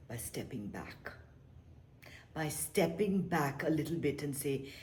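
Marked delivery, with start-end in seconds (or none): 0.1-1.1 whispered. Shown as a demonstration. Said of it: "whispered then repeated".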